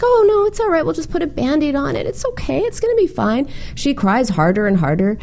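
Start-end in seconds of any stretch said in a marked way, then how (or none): 0.0-3.5 high, sing-song mock soothing; hurried minimizing reassurance. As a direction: hush a crying child in a high voice. Retold soothing of hurt child.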